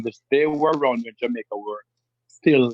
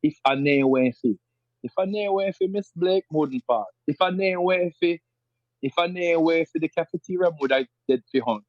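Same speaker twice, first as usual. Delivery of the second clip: higher, lively rural Jamaican patois; 4 semitones higher than usual. Shown as a demonstration. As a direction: speak it like someone from a rural district of Jamaica. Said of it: "rural parts of Jamaica would say".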